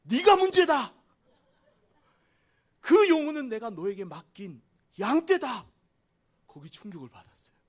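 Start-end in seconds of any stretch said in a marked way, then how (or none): 0.0-5.6 much higher, very loud proclamation; booming voice of God. Retold or imitated. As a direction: thunder the verdict from above. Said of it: "큰 소리로 말씀하는". (크고 높게 울려 퍼지는 하나님의 음성)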